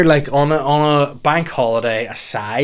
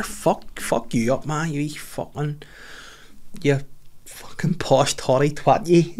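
put-on muttered toddler curses; 3 semitones higher, 6 dB quieter, slower than usual. Kid retaliating, muttered.

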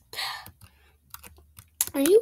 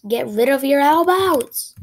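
yelling command; 2 semitones higher, 7 dB louder than usual. Real, not acted. Yelling at character.

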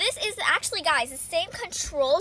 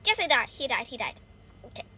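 usual speaking voice

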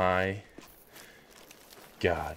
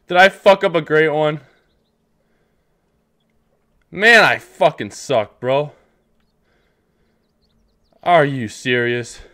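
loud, higher, angry at himself; frustrated yell; 6 semitones higher, 10 dB louder than usual. Real, not acted. Gamer cursing himself after ruining the shot, loud and frustrated. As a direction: blow up at your own mistake. Just missed the great one.

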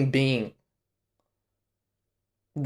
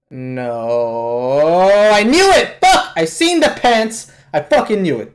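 outraged outburst; 3 semitones higher, 5 dB louder, slower than usual. Real, not acted. Viewer vindicated that he never died, outraged yelling. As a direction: erupt in real disbelief, voice leaping up. Outraged reaction to character's return.

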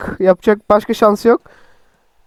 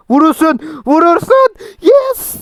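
excited goal cheer; 8 semitones higher than usual. Real, not acted. Scoring a goal, an excited higher cheer. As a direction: erupt as the ball goes in. Shot goes in, celebration.

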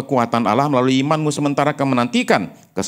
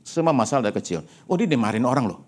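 ordinary talk, nothing marked

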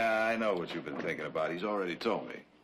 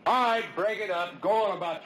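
commanding shout; 10 semitones higher, 4 dB louder than usual. Acted. Breaking up the crowd.

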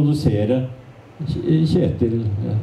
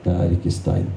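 quiet melancholy; 6 semitones lower than usual. Retold or imitated. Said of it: "sa stille".

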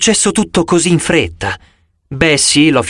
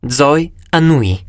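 ordinary delivery

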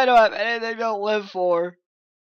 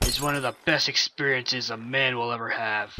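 ordinary delivery

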